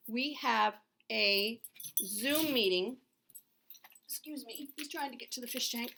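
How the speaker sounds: usual speaking voice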